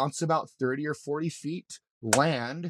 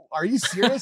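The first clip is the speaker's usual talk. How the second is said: higher, louder retold disbelief; incredulous; 7 semitones higher, 7 dB louder than usual. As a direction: blurt it out in amazed disbelief. Retold incredulous reaction to medic.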